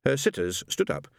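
ordinary delivery, nothing marked

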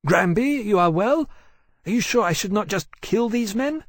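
high, anxious female dragon voice. A narrator's reading. Fiery dragon checking on her captain, anxious. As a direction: fret over him in a high voice. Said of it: "She turned her head back anxiously".

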